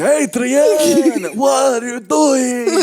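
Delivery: high, loud, drawn-out mock shout; hammed-up movie boxer wailing for his love; Rocky. Mocking co-host's go-to Rocky, high stretched-out yell. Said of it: "But that's your go-to Rocky".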